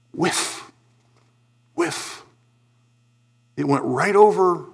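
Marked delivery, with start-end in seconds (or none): 0.1-2.2 soft airy whoosh of a miss; hushed whiff. Retold or imitated. Preacher imitating a missed slow pitch, whispered. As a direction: breathe it out like a ball going by.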